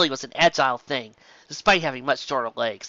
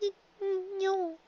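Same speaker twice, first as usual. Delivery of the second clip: trembling no; 8 dB quieter than usual. Acted. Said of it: "lips still trembling".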